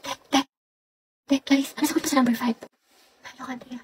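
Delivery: very soft exam whisper; secretive whispering. Acted. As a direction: whisper for an answer without getting caught. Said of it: "may naririnig na ako nagbubulungan". (pabulong na pangongopya)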